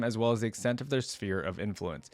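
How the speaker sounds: ordinary talk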